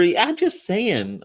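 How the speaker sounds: slow high sing-song; high drawn-out. Acted. Sing-song emphatic aside.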